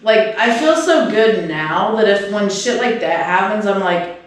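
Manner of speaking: ordinary talk, nothing marked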